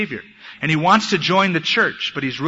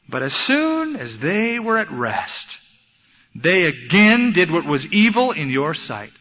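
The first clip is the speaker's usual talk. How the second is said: emphatic scripture reading; 2 semitones higher, slower than usual. Real, not acted. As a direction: stretch the verse out in dismay. Preacher reads the verse with emphasis.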